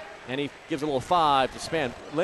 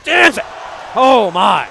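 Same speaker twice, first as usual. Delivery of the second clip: very loud, high commentator shout; explosive dunk call; 6 semitones higher, 13 dB louder, slower than usual. Real, not acted. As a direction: erupt at the slam, then awestruck and loud. Calling a dunk.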